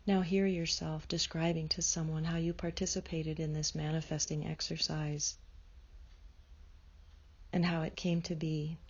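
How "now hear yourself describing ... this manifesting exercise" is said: normal talking voice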